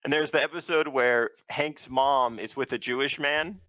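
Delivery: ordinary talk, nothing marked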